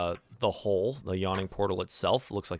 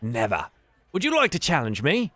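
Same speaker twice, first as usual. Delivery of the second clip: Yagra; 6 semitones higher, 4 dB louder than usual. Acted. Yagra answers Mina.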